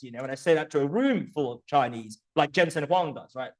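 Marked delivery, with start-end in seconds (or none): none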